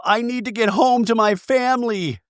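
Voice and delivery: high, whiny mock plea to go home; Angie pleading. Angie begging to get home to her family, whiny. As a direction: pitch up into a pleading whine. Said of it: "we hear Angie go".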